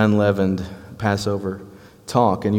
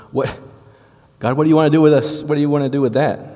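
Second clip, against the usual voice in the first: higher, fast, pleading question to God; anxious Israelites pleading; 6 semitones higher, 4 dB louder, faster than usual. Retold or imitated. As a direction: blurt it out like a worried crowd asking God. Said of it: "they may be thinking".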